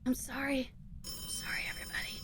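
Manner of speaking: whispering like her voice is gone; lost voice whisper. Retold or imitated. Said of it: "you lose your voice".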